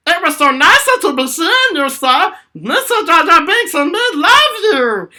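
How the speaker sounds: high, loud goofy Jar Jar shout; yelled Jar Jar Binks voice at Darth Vader. Retold or imitated. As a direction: holler it like a clumsy Gungan fan. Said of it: "I just yelled at him like Jar Jar Binks".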